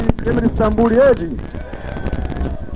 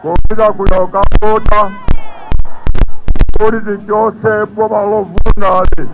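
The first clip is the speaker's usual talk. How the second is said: fiery rally speech; 5 dB louder than usual. Acted. Urging crowd to vote.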